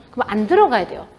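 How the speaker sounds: ordinary talk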